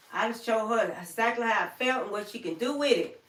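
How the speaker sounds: ordinary delivery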